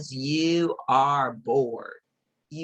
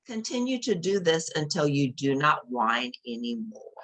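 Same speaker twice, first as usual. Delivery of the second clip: usual speaking voice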